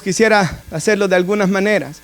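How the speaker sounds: ordinary talk, nothing marked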